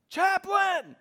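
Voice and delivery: high, loud call across a shop; imitated yell. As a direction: mimic a man hollering for the chaplain. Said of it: "this guy starts yelling at me".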